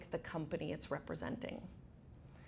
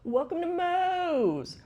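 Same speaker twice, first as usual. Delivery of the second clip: loud, high, drawn-out restaurant shout; big imitated staff welcome yell; yelled greeting; 12 semitones higher, 8 dB louder, slower than usual. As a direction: holler it across the counter, stretching the last word. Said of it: "everyone behind the counter yells".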